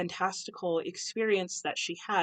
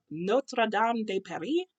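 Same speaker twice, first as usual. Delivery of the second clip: slow, careful French accent on "Notre Dame de Paris", self-consciously amateur; 4 semitones higher, slower than usual. Shown as a demonstration. Booktuber introducing her next read, attempting a self-described horrible French accent. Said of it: "pardon my horrible French accent".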